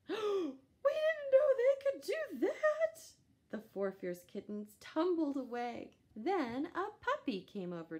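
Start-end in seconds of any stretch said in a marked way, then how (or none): 0.1-3.1 very high, slow amazed voice; astonished kitten. A narrator's reading. Kitten stunned by the duck, high slow amazed wail. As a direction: wail it out in shock.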